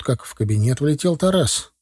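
ordinary delivery, nothing marked

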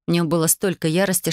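plain reading voice

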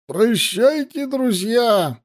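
high-pitched farewell call; drunken shout. A narrator's reading. Drunk guest leaving the tent, calling out goodbye. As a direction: call out to the company, pitched well up. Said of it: "крикнул он, сильно покачиваясь".

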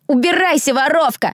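high, scornful dismissal; spiteful jeer. A narrator's reading. Blonde bully to accused thief, spiteful shout. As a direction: chase them off with contempt.

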